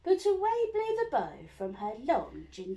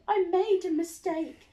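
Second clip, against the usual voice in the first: higher, louder hurried apology; spluttering dragon; 5 semitones higher, 7 dB louder than usual. Acted. Dragon excusing himself, nervous. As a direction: back away nervously. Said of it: "he spluttered".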